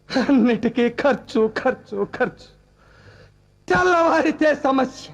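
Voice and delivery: weary despair. Acted. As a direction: let it sag under the weight of expenses. Despairing list of household expenses.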